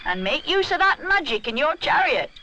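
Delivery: calling after. A narrator's reading. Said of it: "she calls after him".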